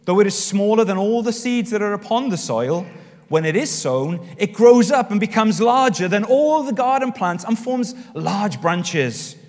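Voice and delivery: loud, high, projected Bible reading, settling at the close; scripture reading. Acted. Preacher reads Mark's parable to the congregation, projected scripture reading. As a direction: proclaim it to the room, easing off at the end.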